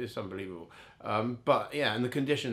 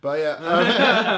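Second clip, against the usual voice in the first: higher and louder through a real laugh; 6 semitones higher, 12 dB louder than usual. Real, not acted. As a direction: laugh the words out. Laughing at friend's teasing.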